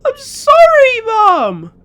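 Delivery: a loud, high wail of sorry; crying apology. Acted. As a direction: beg through tears. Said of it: "eyes began to swell with tears".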